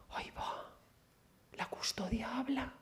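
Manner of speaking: hushed, breathless whisper, slow; awed whisper. Retold or imitated. Woman in church reacting to a voice, awed whisper. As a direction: whisper it as if the altar just spoke.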